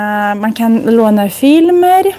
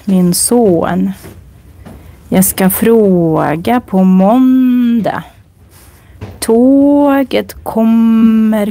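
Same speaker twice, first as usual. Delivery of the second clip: slow model sentences; much slower than usual. Shown as a demonstration. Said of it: "Så man lyssnar och härma".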